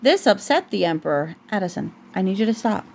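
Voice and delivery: usual speaking voice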